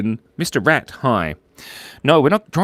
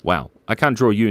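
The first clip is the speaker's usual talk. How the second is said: usual speaking voice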